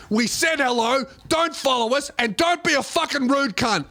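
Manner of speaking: high, firm telling-off; stern rebuke. Retold or imitated. Rapper friend confronts the drunk follower, firm high rebuke. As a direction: put the drunk in his place.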